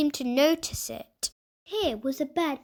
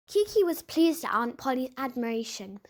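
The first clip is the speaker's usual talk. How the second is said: usual speaking voice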